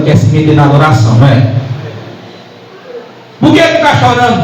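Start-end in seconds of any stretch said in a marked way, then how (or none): 3.4-4.4 high-pitched, intrusive question; acted busybody interrupting a prayer; pry in a raised pitch; meddling questioner. Acts meddler questioning a worshipper.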